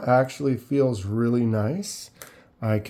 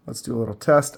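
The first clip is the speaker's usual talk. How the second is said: normal talking voice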